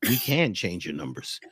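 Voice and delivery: imitating a low, serious expert; really serious. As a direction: drop low and soft, mock-earnest. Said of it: "he got really serious and said".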